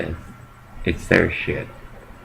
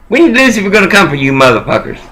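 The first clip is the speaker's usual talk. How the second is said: angry outburst; 7 semitones higher, faster than usual. Real, not acted. Ranting at his opponents, a fast angry outburst. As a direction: spit the threat out fast and high.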